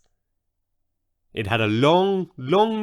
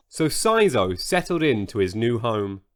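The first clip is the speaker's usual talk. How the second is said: ordinary delivery, nothing marked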